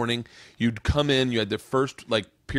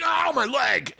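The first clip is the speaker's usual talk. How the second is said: high mock yell of pain; 13 semitones higher, slower than usual. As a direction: cry out over a sore leg. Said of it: "Ty would be like".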